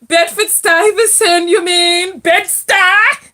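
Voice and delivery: loud, high-pitched excited check; real excitement. Real, not acted. Host reacting to the caller's Brooklyn neighborhood, excited.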